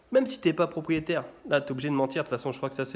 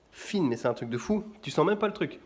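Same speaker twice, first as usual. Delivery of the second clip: ordinary talk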